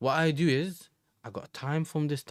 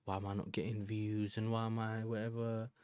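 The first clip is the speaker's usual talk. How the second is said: mock whiny complaint; 2 semitones lower, 7 dB quieter than usual. Streamer mocking underrated creators, soft mock whine. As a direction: make fun of a sulky creator's whine. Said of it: "I don't really like to do the whole".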